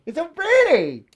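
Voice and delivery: sing-song, drawn-out "so pretty" up high; high, slow "It's so pretty". Shown as a demonstration. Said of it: "repeated line, high slow take".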